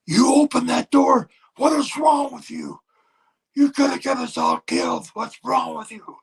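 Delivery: high, loud, furious mock scolding; a retold pilot tearing into a man for opening the door; berserk pilot. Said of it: "the pilot went berserk".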